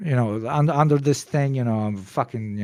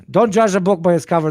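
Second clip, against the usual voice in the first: emphatic; 7 semitones higher, 8 dB louder than usual. Real, not acted. Emphatic moral to the story.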